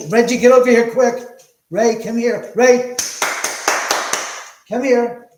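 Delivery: high, loud call across the room; calling the dog. Real, not acted.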